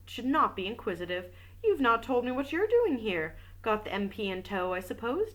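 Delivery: even audiobook reading voice; story narration. A narrator's reading. Reading a detective novel aloud, steady narration. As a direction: read the novel aloud to listeners.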